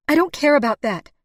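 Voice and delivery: high loud outburst; blurted out. A narrator's reading. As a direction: blurt it out, cutting him off. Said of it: "she blurted out".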